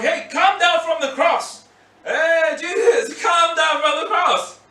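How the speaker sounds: loud high jeering yell, slow and drawn out; mocking shout. Crowd yelling up at Jesus on the cross, mocking shout. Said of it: "everyone's still mocking him and going".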